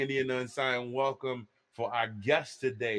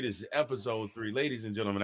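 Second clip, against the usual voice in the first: usual speaking voice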